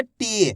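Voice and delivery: टी held long on the ee; drawn-out vowel. Shown as a demonstration. Teacher repeating the answer for students, टी drawn out. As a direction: draw out टी as one long syllable. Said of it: "खींच के पढ़ेंगे".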